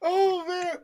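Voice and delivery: after laughing hard, out of breath; gasping out words between laughs; crying laughing. Real, not acted. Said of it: "I'm crying laughing".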